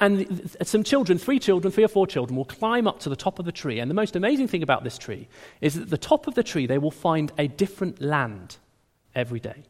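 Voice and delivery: ordinary talk, nothing marked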